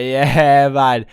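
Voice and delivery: very slow, drugged-out drawl; imitating slurred speech. Zoned-out friend admits he took the pills, imitated slurring. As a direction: drag the words out, mock-wasted and barely articulate. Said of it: "sounded like his mouth was falling apart".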